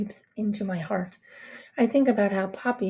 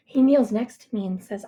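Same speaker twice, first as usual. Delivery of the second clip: usual speaking voice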